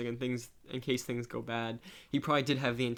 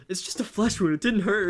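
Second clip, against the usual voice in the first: mock tough guy; 8 semitones higher, 6 dB louder than usual. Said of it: "he's like".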